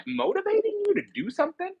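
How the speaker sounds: mocking high voice. Mocking a partner's motives.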